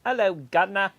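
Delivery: mock London accent. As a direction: tip the hat, cockney style. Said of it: "You sound like you're from London".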